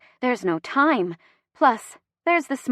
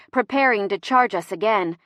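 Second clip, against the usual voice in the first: ordinary delivery, nothing marked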